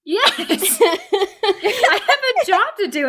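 high, laughing outburst; laughing protest. Acted. Player defending running off, high laughing protest.

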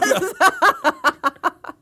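high giggling laughter after a snort; snorting laughs. Real, not acted. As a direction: laugh helplessly, with little snorts. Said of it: "Did I just snort on the microphone?".

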